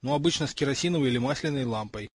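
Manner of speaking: usual speaking voice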